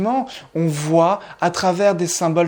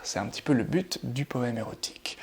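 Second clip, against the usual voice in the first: softer, whispered aside; confiding whisper; 4 semitones lower, 8 dB quieter, faster than usual. Acted. Teacher confides the poem's aim to viewers in a whisper. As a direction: let it slip as a whispered secret.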